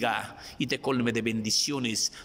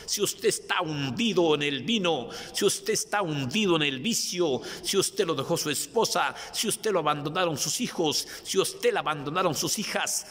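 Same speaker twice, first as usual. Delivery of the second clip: fervent preaching; 5 semitones higher than usual. Acted. Preaching with rising fervour.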